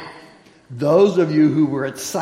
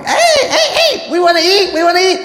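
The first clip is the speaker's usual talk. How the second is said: much higher, much louder squawky chicken voice with laughter; hungry chickens; 8 dB louder than usual. Acted. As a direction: laugh through a clucking demand for food. Chickens voiced demanding food.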